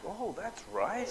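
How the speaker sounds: high, slow, drowsy "oh that's right"; slow dawning realization. Retold or imitated. Woken by sirens, slowly remembering the missiles, drowsy realization. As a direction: let it dawn on you lazily.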